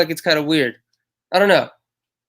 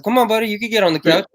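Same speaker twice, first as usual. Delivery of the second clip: higher, coaxing pet voice; sing-song dog-owner voice, pitched up; talking to the dog; 6 semitones higher, faster than usual. Retold or imitated. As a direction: coax the dog up onto the couch. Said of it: "and I was like".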